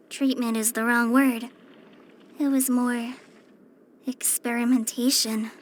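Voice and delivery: low, halting confession; sombre recollection. Acted. Recounts painful past treatment.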